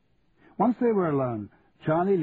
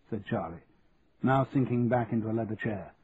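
usual speaking voice

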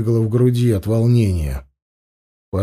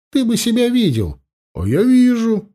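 lightly voiced youth; 11 semitones higher than usual. A narrator's reading. Characters voiced in story.

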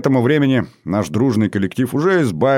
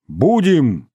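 higher, lively call back; eager group reply; 6 semitones higher, 3 dB louder than usual. A narrator's reading. Hungry guests answer the hostess's offer, eager call. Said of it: "отозвался хор вечно голодных".

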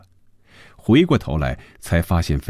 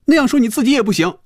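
high, fast, raised voice; loud protest; 4 dB louder, faster than usual. A narrator's reading. Phoebe forbids him to belittle himself, loudly. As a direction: raise your voice sharply in objection. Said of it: "菲比大声说".

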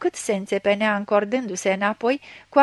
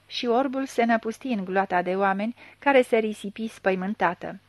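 ordinary delivery, nothing marked

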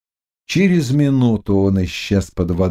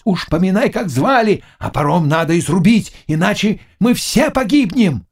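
high, frantic yell; screaming as if flayed; 10 semitones higher than usual. A narrator's reading. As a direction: cry out in alarm, urging everyone on. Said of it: "закричал, точно с него сдирали шкуру".

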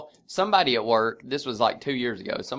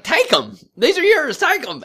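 high, loud insisting coworker pressing food on him; mock pushy coworker; 6 dB louder than usual. Coworker to Brooks, pushing chicken tenders, high and insistent. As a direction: push the tenders on him, pitched way up. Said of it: "Kenny was like".

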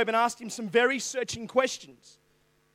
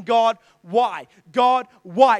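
anguished cry raised louder; crying out why; 7 dB louder, much slower than usual. Retold or imitated. Preacher voicing Job crying out to God, louder plea. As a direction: plead up at heaven, voice rising. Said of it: "he starts saying".

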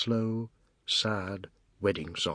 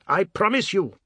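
shouted vow; 9 semitones higher, 6 dB louder than usual. Acted.

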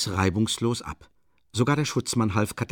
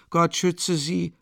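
pious woman, slowly; 8 semitones higher, much slower than usual. A narrator's reading. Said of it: "bemerkte eine Frau und schlug ein Kreuz".

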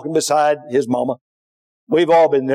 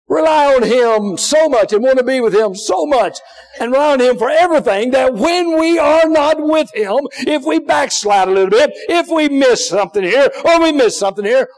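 usual speaking voice

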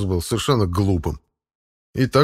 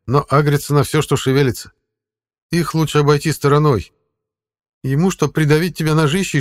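higher, louder voice for the talking cat; 6 semitones higher, 3 dB louder than usual. Acted. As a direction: play the sly cat guide. Cat character voice.

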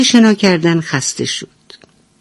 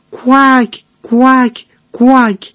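higher calling quack from far off; mother duck call; 7 semitones higher, 3 dB louder, much slower than usual. Acted. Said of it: "از دور صدایی شنید".

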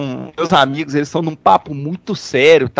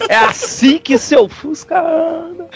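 much higher, slow, laughing; high laughing catchphrase; 12 semitones higher, slower than usual. Acted. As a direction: squeal it through laughter. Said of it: "silly catchphrase".

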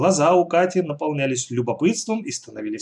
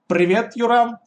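breezy, cheeky high greeting; a bit cheeky; 8 semitones higher, 4 dB louder, slower than usual. A narrator's reading. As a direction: toss it off a touch cheeky. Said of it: "чуть развязно ответила Катя".

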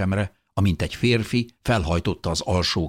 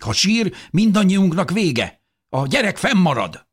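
very high, louder alarmed voice; agitated objection; 13 semitones higher, 5 dB louder than usual. A narrator's reading. Bystander refuses to let the baby down, agitated. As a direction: insist in high-pitched agitation. Panicked crowd quarrel over crying baby.

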